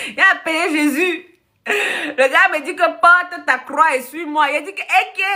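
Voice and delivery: high, laughing and half sobbing through the words; can barely get it out for laughing; laughing while telling. Real, not acted. Telling her Jesus story to the live audience, laughing high.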